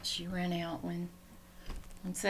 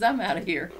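louder, mock-huffy cat line; voicing the cat; 9 dB louder than usual. Owner voices her cat leaving in a mock huff. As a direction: play the cat storming off. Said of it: "She goes".

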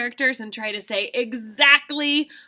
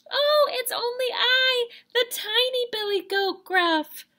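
high, squeaky little goat voice; tiny billy goat voice. Shown as a demonstration. Teacher reads to kindergartners as the tiny goat, high squeak. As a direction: play the tiny goat, small and timid. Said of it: "And he said this".

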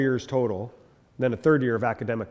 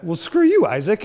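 sharp mock retort, pitched much higher and louder; feisty retort; 7 semitones higher, 5 dB louder than usual. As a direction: snap back in mock offense. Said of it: "I get a little, little feisty".

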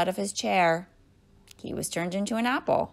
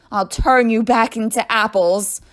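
loud, raised-pitch menace; screamed threat; 5 semitones higher, 11 dB louder than usual. A narrator's reading. Teacher to escaping pupils, screamed threat. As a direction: threaten at full volume. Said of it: "screamed Missus Gorf".

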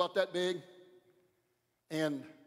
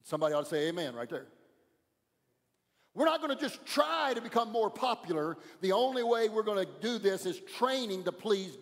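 louder, driving sermon cadence with punched words; preaching at full force; 3 semitones higher, 5 dB louder than usual. Acted. Pastor exhorts the church to train not try, fervent preaching. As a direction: preach it, building the call to train.

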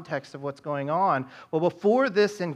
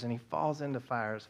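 softer, lower retelling of the fires; hushed retelling; 5 semitones lower, 10 dB quieter than usual. Real, not acted. Pastor to congregation, pained father's story, hushed. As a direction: drop the voice as if grieving with him. Same words retold, hushed.

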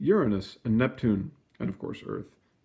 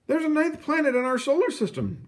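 declaring. A narrator's reading. Astronomers declaring the new planet, very high. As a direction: announce it like big news. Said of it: "declared Lowell's astronomers".

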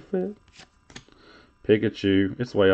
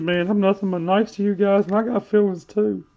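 mock whine; 6 semitones higher, 3 dB louder than usual. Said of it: "He said".

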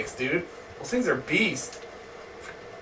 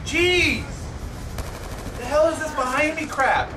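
frustrated squawk, pitched way up; exasperated outburst; 11 semitones higher than usual. Real, not acted. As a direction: yell in disbelief at being ambushed. Killed from behind in game.

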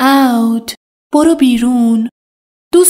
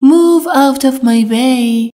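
slow model sentence; slower than usual. Shown as a demonstration. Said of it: "teacher models an English sentence".

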